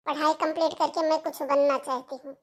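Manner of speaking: ordinary talk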